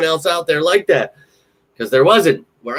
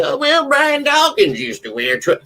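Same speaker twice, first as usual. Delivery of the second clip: much higher put-on voice; nerdy fan trivia, squeaky; mocking know-it-all; 13 semitones higher than usual. Imitating himself as annoying fan.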